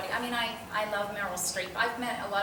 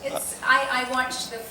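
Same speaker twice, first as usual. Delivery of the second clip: ordinary delivery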